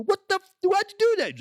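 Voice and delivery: high, loud outraged squawk; hammed-up indignant cry; indignant lobster. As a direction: squeal it up high in mock outrage. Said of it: "look at the other lobsters and go".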